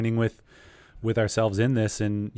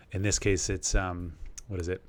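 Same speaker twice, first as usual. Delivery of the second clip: ordinary talk, nothing marked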